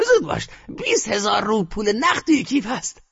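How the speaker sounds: put-on high bossy old woman; grandmother ordering. A narrator's reading. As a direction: push the bet on him. Grandmother hurries him.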